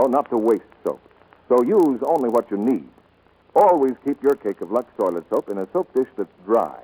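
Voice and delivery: ordinary delivery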